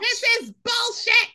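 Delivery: high, squeaky indignant outburst; mock outrage at the movie. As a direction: exclaim in disgust.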